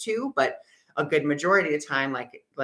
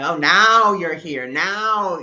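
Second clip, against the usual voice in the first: sarcastic yell; 3 semitones higher, 8 dB louder, slower than usual. Retold or imitated. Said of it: "yelling at Spirit".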